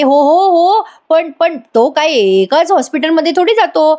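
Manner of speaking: high, flustered stammering pretext; flustered excuse. Acted. Priya stammering excuses to Arjun, high and flustered.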